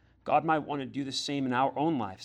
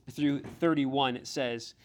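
usual speaking voice

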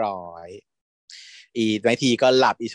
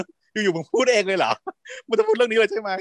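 incredulous; 9 semitones higher than usual. Retold or imitated. Incredulous retold reaction.

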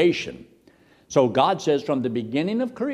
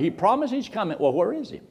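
higher-pitched mild taunt; mocking scoffers; 7 semitones higher, faster than usual. Scoffers questioning the promise of his coming, mildly mocking. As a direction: pitch it up as a doubting jibe. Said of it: "They're gonna mock and make fun".

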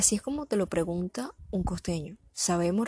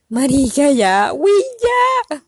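loud, higher mock exasperation; exasperated outburst; 8 semitones higher, 12 dB louder, slower than usual. To the classmate who keeps asking questions, exasperated outburst. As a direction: burst out, fed up, much louder.

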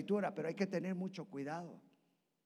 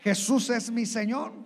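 loud confession; 5 semitones higher, 11 dB louder than usual. Shown as a demonstration. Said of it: "todos pudieron decir".

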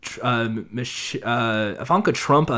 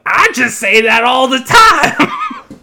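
loud, high, drawn-out bad Australian accent; Jim Jeffries; 10 semitones higher, 7 dB louder, slower than usual. Comedian jumping in on the apology row, bad Australian shout. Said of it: "was this voice 'cause you can't do an Australian accent".

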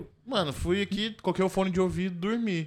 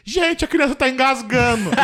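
high, slow panicked call; mock alarmed passenger; retold shout; 7 semitones higher, 6 dB louder, slower than usual. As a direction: cry out for help across the cabin. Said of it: "alguém gritou assim".

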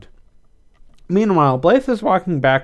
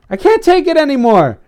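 high, loud cry of exasperation; fed up, pitched high and loud; exasperated outburst; 13 semitones higher, 7 dB louder than usual. A narrator's reading. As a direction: burst out at the end of your tether. Exasperated character outburst opens scene.

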